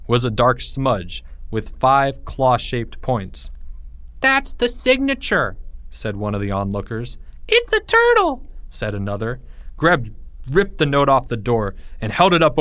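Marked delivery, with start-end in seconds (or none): 4.2-5.6 high-pitched exclamation from the crowd; excited onlooker. A narrator's reading. Onlooker spots the mark on the note, excited. 7.5-8.4 put-on very high, squeaky kid exclamation; excited onlooker. A narrator's reading. Another onlooker recognises the mark, excited.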